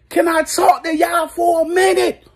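shouted high, straining to be heard. Real, not acted. Fan yelling to get everyone's attention, high shout. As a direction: yell over the noise for attention.

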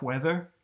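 "Weather" starts with an audible H, like "hweather"; breathy H in weather. Shown as a demonstration. Showing viewers the actor's H sound, "Weather" with an H. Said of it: "He pronounces the H in weather".

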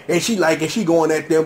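ordinary talk

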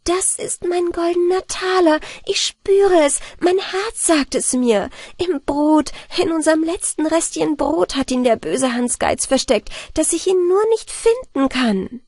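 joyful, excited Anna Barbara; joyful cry. A narrator's reading. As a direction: cry out the find with delight. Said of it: "rief freudig".

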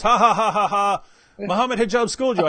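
imitated jeering "ha, ha, ha"; mock crowing over a beaten opponent; mock gloating. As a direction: put on a commenter's triumphant fake laugh. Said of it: "I still get comments from Muslims".

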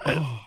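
soft mock moan. Retelling a friend's moan, quietly imitated. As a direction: mimic his little moan quietly. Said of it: "and he went".

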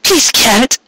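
put-on high young mare's voice, trailing off unsure; young mare, hesitant. A narrator's reading. Mare tells Carrot how long it will take, hesitant. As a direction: voice her high and tentative.

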